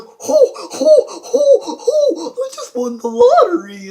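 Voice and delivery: high, loud whooping celebration; hammed-up jubilation. Burglar leaving with the loot, hammed-up whooping joy. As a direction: whoop it way up, laughing.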